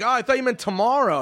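high, put-on innocent excuse; mock innocence. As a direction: play dumb with a lifted voice. Band playing dumb with guard.

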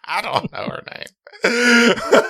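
squeaky high laugh through the words; giggling so hard the voice cracks. Real, not acted. Cohost cracking up while naming his relatives. Laughing fit over the joke.

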